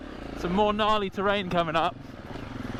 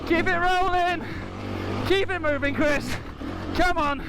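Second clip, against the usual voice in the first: high, urgent shouts of encouragement; urgent cheering; 10 semitones higher than usual. Real, not acted. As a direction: egg the rider on, pitched up. Cheering rider up climb.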